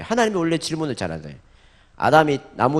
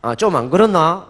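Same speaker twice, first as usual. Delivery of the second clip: louder, higher Busan-style question 안 그렇나; Busan dialect; 5 semitones higher, 7 dB louder than usual. Shown as a demonstration. Preacher tries Busan dialect for the congregation, louder and higher. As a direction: try the local Busan ending on 안 그렇나. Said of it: "여기 부산이구나".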